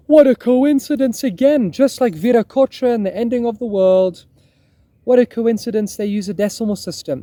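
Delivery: high, loud theatrical astonishment; mock amazement. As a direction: exclaim like an over-amazed viewer. Acts out an astonished viewer's reaction.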